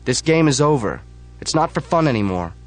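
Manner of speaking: high, loud cry to stop; urgent shout. Acted. Narrator tries to shut down the club, loud shout. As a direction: stop the crowd cold. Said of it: "I say".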